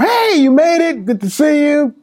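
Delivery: loud, high-pitched retold greeting; delighted greeting. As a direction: imitate a mother sing out hello. Mother greets son arriving home.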